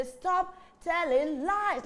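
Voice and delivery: slow, firm repeat. Shown as a demonstration. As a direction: lead the children slowly.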